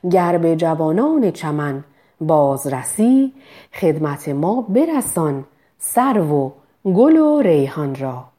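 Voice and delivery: slow, measured recital of verse; poetry recitation. Shown as a demonstration. Diction teacher reciting Hafez slowly to students. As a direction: recite the line slowly, weighing each word.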